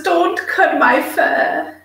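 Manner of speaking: slow, high silly dog voice; stupid dog voice. Acted. Owner voicing her dog being trimmed, slow silly dog voice. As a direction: play the dog pleading, drawn out. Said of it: "That's me in my stupid dog voice again".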